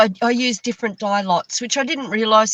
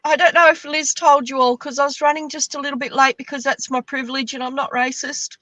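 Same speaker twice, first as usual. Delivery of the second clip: usual speaking voice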